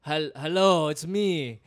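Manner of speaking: demonstrating a rough Eastern European accent; improvised Eastern European greeting voice. Shown as a demonstration. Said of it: "it's, like, Eastern European".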